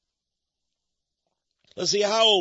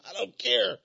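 usual speaking voice

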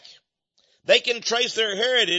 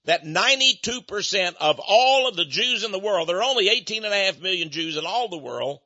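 ordinary talk

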